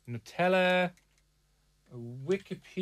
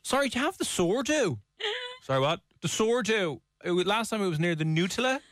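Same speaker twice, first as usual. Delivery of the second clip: mock posh shopper; 7 semitones higher than usual. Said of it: "mocking friend shopping".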